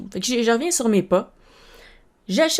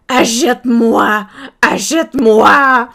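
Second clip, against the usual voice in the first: loud, slow begging toy voice; loud drawn-out plea of a plush lemur; pleading plush toy; 4 semitones higher, 11 dB louder, much slower than usual. Pretend. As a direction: beg like the toy in the store. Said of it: "il me dit".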